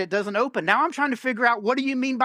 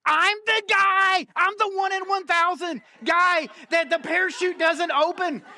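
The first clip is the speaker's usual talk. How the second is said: mock disbelief; 5 semitones higher than usual. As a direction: play up being the unlucky one. Realizes his parachute failed, mock outrage.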